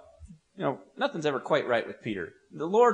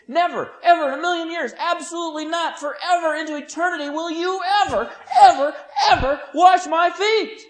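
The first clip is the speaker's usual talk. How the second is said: emphatic negation; 12 semitones higher, 7 dB louder than usual. Acted. Peter rock solid against having feet washed, emphatic. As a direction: build to an adamant, hammed-up shout. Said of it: "the strongest, most emphatic".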